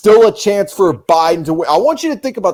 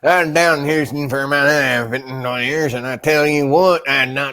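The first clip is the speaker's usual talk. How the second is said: Texas accent. Shown as a demonstration. Showing friend how Texans talk, Texas accent. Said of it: "they talk like this down".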